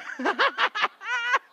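loud high burst of laughter; real laugh. Real, not acted. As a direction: crack up at the joke.

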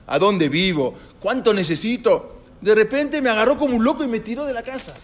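high, rushing, indignant complaint; 1 agitated retelling. Retold or imitated. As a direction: tell your wife, still shaken and outraged. Moshe cuenta indignado a su esposa. (Moshe, alterado, le cuenta a su esposa)

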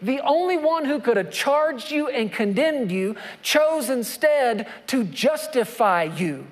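usual speaking voice

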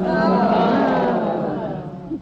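a room full of people groaning together; crowd groan. Real, not acted. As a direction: collective groan of mock dismay. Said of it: "Let's just have a collective groan".

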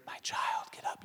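hushed whisper close to the ear; soft, reverent whisper; tender whisper. Acted. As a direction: whisper it gently into a sleeping child's ear. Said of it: "he whispers in her ear".

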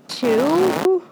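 jumps high on the word, amazed; a startled squeak of disbelief; surprised. Acted. Mouse learning the cat is named Ralph too, surprised and high.

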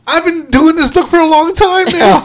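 high-pitched comic indignation; indignant protest. Retold or imitated. Man jokingly claiming credit for dad bod, indignant. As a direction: pitch it way up, playfully aggrieved. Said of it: "and I'm like".

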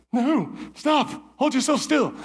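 high, tense self-talk to stop shivering; panicked plea. Retold or imitated. Sinking in mud, telling himself to hold still, panicked. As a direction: urgently tell yourself to freeze.

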